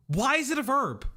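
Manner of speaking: hammed-up disbelief; mock outrage. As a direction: act baffled at the word. Complaining about a chat term.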